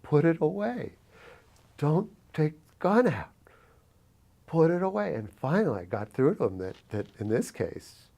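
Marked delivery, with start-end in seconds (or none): 0.0-5.1 higher, pressing plea to calm him; urgent pleading. Retold or imitated. Teen urges the armed manager to put the gun away, pleading. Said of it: "And I remember saying".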